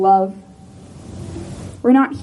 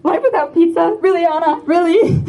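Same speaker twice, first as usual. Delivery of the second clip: put-on playful Spanish accent, higher, in disbelief; 7 semitones higher than usual. Friend mimics Celia's Spanish accent, shocked at life without pizza. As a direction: mimic a Spanish speaker's shocked, teasing question. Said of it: "in her Spanish accent".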